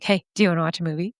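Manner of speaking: ordinary talk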